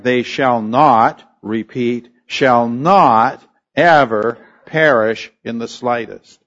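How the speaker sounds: slow, stressing "not" in "shall not ever perish"; emphatic literal translation. Shown as a demonstration. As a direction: drag it out, hammering each "not". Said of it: "a literal translation would be something like".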